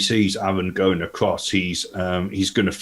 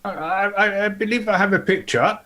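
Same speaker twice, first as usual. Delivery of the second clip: much higher character voice, eager; 12 semitones higher than usual. Acted. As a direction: pitch it way up, an eager investigator.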